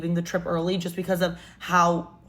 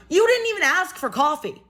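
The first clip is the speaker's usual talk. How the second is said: exasperated protest; 7 semitones higher than usual. Retold or imitated. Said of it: "I was like".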